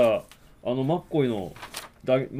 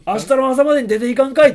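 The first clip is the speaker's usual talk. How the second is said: much higher and louder Kansai-dialect shouted order; Kansai dialect cop; 12 semitones higher, 13 dB louder than usual. Retold or imitated. Cop in Kansai dialect yells at the hero to leave. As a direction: yell him out of town in thick Osaka speech. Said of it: "関西弁だ。".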